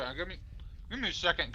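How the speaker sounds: mouth full. Real, not acted. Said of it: "'cause I'm chewing".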